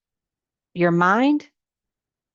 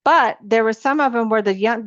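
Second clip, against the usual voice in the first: normal talking voice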